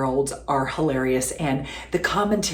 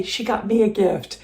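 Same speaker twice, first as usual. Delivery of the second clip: ordinary talk, nothing marked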